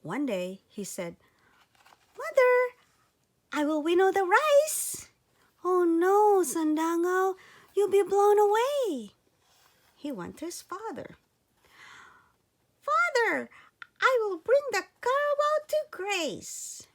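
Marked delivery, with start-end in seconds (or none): 2.2-5.1 put-on high, loud eager little boy voice; a tiny boy pleading to help, pitched high and loud; little boy Sandangao. Child hero voiced in story. 5.6-9.1 high, loud alarmed mother; worried mother. Acted. Mother voiced in story. 12.8-16.9 put-on high, loud eager little boy voice; a tiny boy pleading to help, pitched high and loud; little boy Sandangao. Child hero voiced in story.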